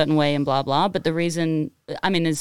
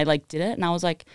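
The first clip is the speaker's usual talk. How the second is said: ordinary talk, nothing marked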